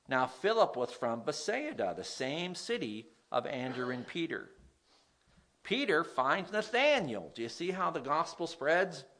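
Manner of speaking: usual speaking voice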